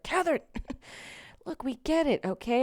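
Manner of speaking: high-pitched, fed-up teacher; imitated exasperated teacher; mock exasperation. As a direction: cut the keen pupil short. Imitates teachers cutting her short.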